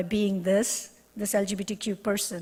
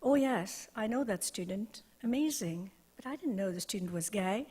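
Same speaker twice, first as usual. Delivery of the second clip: hushed, much softer confiding voice; confidential, conspiratorial; 9 dB quieter than usual. Retold or imitated. Someone confiding about a student they know, confidential and conspiratorial. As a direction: drop the volume as if sharing a secret. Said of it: "in a confidential, conspiratorial voice".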